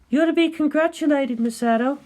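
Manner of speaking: cool, measured colleague; Ritsuko's voice. Acted.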